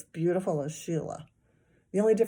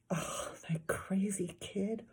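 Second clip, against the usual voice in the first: whisper; 6 dB quieter, slower than usual. A narrator's reading. Narrator aside.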